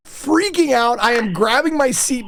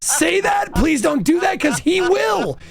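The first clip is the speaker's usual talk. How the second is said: pleading; 7 semitones higher, slower than usual. Retold or imitated. Pleading with girl in retold fight.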